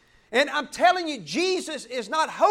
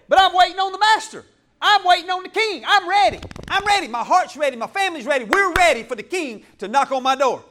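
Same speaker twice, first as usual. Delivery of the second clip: loud, fast, higher proclamation; fired-up declaration; 3 semitones higher, 6 dB louder than usual. Acted. Preacher declares his household ready for the king, loud and fired up. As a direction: shout that you're ready for the king.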